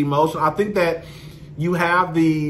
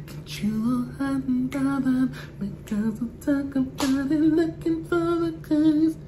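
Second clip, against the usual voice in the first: high breathy sexy whisper, half sung; little whisper voice; 8 semitones higher, 5 dB quieter than usual. Retold or imitated. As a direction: coo it like a sultry pop singer. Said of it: "have a little whisper voice".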